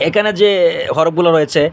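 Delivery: normal talking voice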